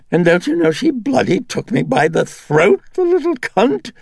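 high, loud wheedling dandy. A narrator's reading. The Dennings personality wheedling at Karras to let it stay, high and loud. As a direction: coax him, all charm and grievance. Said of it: "The voice was whiny now".

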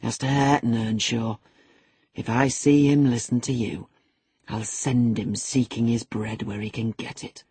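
low, controlled menace; forced calm over simmering anger; assumed calmness. A narrator's reading. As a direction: keep the threat low and measured. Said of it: "said with assumed calmness".

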